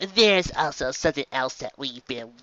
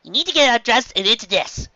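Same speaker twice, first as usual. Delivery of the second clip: much higher, squeaky small doctor; high little Chopper; 7 semitones higher, 6 dB louder than usual. Acted. Chopper answering Sanji about Luffy, high squeaky voice. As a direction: squeak it like a tiny reindeer.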